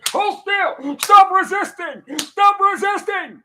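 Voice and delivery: high, slow mock-cop shout of stop resisting. Mocking cops beating a handcuffed man.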